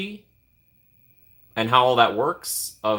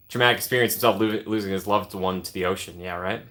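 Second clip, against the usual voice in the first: ordinary delivery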